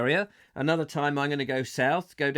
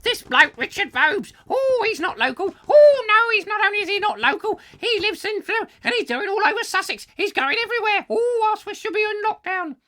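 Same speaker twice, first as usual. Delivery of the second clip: very high, loud shrill-voiced mockery; high mocking busybody; 8 dB louder than usual. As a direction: squeal it like a fussy busybody. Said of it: "this person was like".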